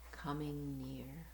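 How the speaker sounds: plain reading voice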